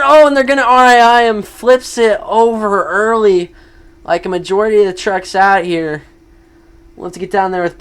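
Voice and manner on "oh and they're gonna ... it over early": excited. Real, not acted. Truck flips over.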